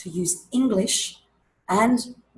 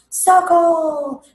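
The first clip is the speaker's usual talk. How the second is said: much higher, louder drawn-out circle; slow down then burst out the answer; big reveal; 10 semitones higher, 10 dB louder than usual. Shown as a demonstration. Teacher finishing the shake game, loud reveal. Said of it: "Take the lid off".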